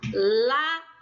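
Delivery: "Lata" broken in two, LA loud and long; stress on LA. Shown as a demonstration. Teacher pronouncing with the class, "Lata" stressed on LA. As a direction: punch the LA of "Lata", then let TA drop. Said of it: "a sílaba forte é lata".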